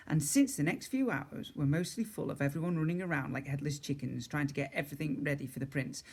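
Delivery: plain reading voice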